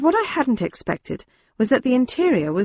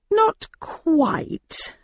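slowly; 9 semitones higher than usual. Acted. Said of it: "I replied slowly".

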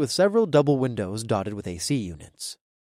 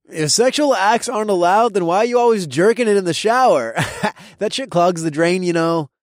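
mocking frat bro; 7 semitones higher, 5 dB louder than usual. A narrator's reading. Toby taunts RA.

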